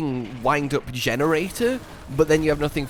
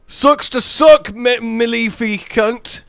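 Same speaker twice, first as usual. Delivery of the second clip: slow, high, loud gloating; rude taunt; 8 semitones higher, 7 dB louder, much slower than usual. Real, not acted. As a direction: drag out the insult to rub it in. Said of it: "ruder than he needed to be".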